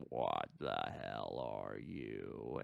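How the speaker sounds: very low, slowed alien echo; giant alien booming voice. Retold or imitated. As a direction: drag it out deep and monstrous. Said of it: "Predator ends up replying".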